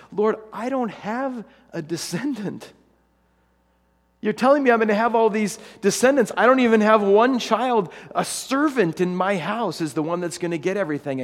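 high, loud, protesting cry; exasperated Abraham. Acted. Abraham protesting to God he has no child, loud and high.

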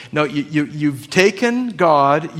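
normal talking voice

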